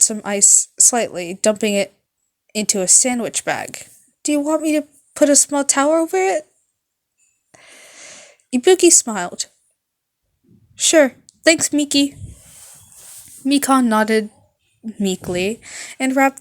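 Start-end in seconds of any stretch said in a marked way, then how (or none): 4.2-6.5 high, fast timid offer; quick high shy question; timid nurse. Acted. 10.7-12.2 high, loud cheerful thanks; bubbly rock girl. Acted. Ibuki voiced.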